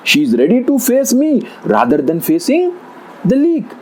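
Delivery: high-pitched, incredulous protest; indignant tiger. A narrator's reading.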